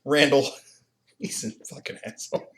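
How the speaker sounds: ordinary talk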